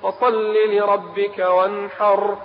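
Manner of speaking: slow, measured chant of the verse; Quranic recitation. Shown as a demonstration. Preacher reciting the surah to listeners, measured chant. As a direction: recite it slowly and melodically.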